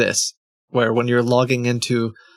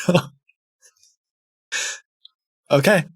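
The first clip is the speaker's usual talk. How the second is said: ordinary talk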